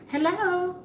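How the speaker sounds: high, shy little hello; a hint of a flustered woman; nervous hello. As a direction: greet him nervously, voice jumping up. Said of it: "she walks out there all nervous".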